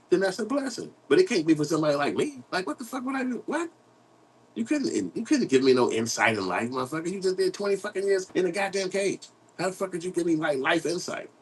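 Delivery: high-pitched, incredulous rant; indignant disbelief. Real, not acted. Speaker rejecting life advice from an ex-prisoner, indignant disbelief. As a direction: protest it in a high, baffled voice. Indignant at being preached to by ex-convict.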